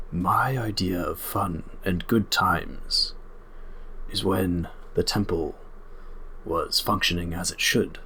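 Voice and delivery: slow, deliberate centaur voice; centaur guardian, disapproving. Acted. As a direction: weigh each phrase solemnly, with long pauses.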